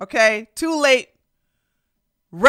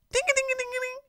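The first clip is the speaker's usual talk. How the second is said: high sung bell dings; mock bell; 14 semitones higher, 8 dB quieter, much faster than usual. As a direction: ring it like a shame bell. Bell sound imitation.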